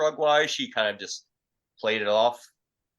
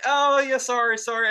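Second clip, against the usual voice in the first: much higher, louder, imitating a sugary sorry; imitating the slapper's sweet sorry; mock sweet apology; 13 semitones higher, 4 dB louder than usual. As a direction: play the woman going suddenly innocent. Slapper's sudden apology, voiced.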